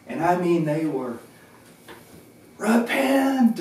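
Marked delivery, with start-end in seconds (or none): 2.6-3.6 high, loud street-preacher cry; mock screamed preaching. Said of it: "screaming out all these words".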